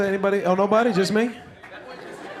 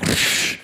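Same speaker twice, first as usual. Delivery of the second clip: imitated whoosh of a blow landing; kung fu sound effect. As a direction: make a sharp kung fu hit noise with the mouth. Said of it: "They got all the sound effects".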